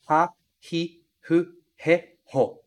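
"ha, hi, hu, he, ho" with a clear breathy h; clearly pronounced h. Shown as a demonstration. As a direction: sound every H fully. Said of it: "pronunciando essas consoantes muito bem".

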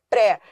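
PRÉ said strong with an open é; stressed, with force. Shown as a demonstration. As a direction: hit "pré" firmly, open vowel. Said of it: "força".

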